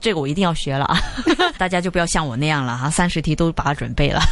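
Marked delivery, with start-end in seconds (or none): 1.0-1.5 short giggle, high and rising; a quick embarrassed laugh. Real, not acted. Host laughing at her own over-preparing, a high rising giggle.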